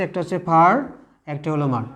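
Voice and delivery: ordinary delivery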